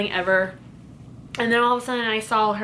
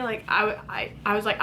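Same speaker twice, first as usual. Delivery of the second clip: ordinary talk, nothing marked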